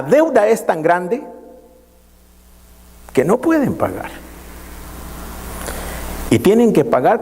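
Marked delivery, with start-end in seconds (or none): none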